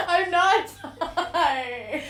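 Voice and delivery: high, loud laughing protest; laughing denial. Real, not acted. Laughing denial of being Thai.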